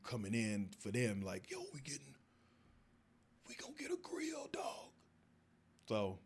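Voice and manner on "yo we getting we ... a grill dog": high, hushed bro talk hyping grills; a mock homie whisper-shout; hyped friend voice. Friend hyping up gold grills, hushed and high.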